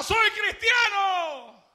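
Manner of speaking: high, loud, very slow cry; shouted. A narrator's reading. Tortured believer shouts his faith, high, loud and very slow. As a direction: defy the torturers. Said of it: "él gritaba".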